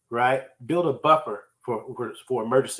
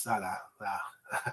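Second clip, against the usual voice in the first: slow, soft, lower drawn-out nah; mock dismissive nah; 5 semitones lower, 13 dB quieter, much slower than usual. Coworker shrugging off the question, slow soft dismissive nah. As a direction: brush it off lazily. Acting the asker's answer.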